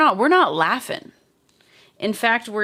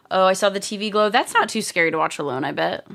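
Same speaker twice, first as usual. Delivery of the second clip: ordinary talk, nothing marked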